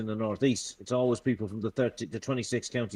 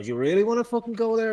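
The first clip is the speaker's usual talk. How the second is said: high, fast mock challenge; retold threat to a posh snob, high and fast; 10 semitones higher, faster than usual. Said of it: "you know".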